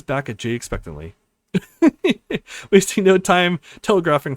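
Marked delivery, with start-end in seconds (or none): 1.8-2.6 high burst of laughter. Real, not acted. Laughs at Mallory's smirk.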